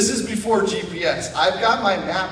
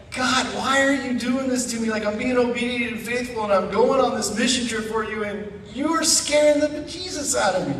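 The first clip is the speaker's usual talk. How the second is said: high-pitched desperate complaining to God; exasperated plea; 8 semitones higher than usual. Retold or imitated. Scared traveler crying out to God in high mock despair. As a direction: whine it up at God, near breaking.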